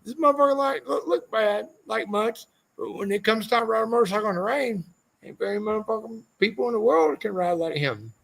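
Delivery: high, hammed-up Walker introducing a rider; imitating Walker. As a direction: do Walker, pitched high and playful. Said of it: "He's like".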